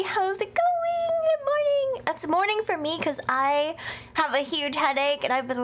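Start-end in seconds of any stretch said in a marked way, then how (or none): 0.0-1.1 much higher cheery hello; high sing-song greeting. Real, not acted. 1.3-2.0 much higher bright good morning; high cheery greeting. Real, not acted. Second greeting, pitched up.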